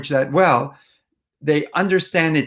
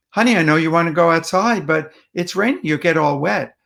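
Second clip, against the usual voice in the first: quick, run-on parental explanation; too fast; 2 semitones higher than usual. Shown as a demonstration. Parent to toddler wanting outside, rushed explanation. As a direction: rattle it off in one go. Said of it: "That went by way too fast".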